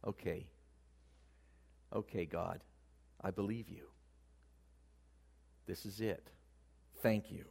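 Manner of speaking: low, soft, with long pauses; hushed reply to God, taking it in; quietly accepting Abraham. Acted. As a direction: say it softly and low, almost to himself. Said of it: "It was like Abraham said".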